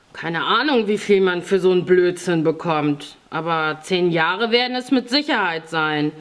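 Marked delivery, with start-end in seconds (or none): none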